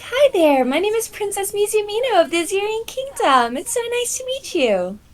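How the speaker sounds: very high, sugary princess voice; prim princess. Acted. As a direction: introduce yourself like a pompous little princess. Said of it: "put her hand on her chest and be like".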